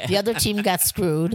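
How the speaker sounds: usual speaking voice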